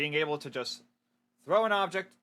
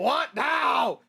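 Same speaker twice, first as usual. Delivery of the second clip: loud, higher irritated shout back; annoyed yell; 7 semitones higher, 6 dB louder than usual. Acted. Son answering his mom from his room, annoyed shout.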